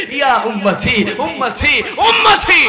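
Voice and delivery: loud, high drawn-out calls; imitated cry. Preacher voices the Prophet crying for his people, imitated cry. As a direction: call out in mounting waves.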